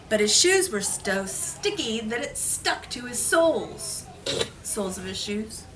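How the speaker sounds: ordinary delivery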